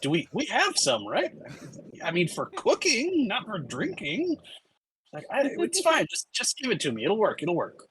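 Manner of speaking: suara tinggi yang pasrah; tinggi, sedikit kesal lalu menyerah; exasperated bartender. Acted. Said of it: "Leda goes".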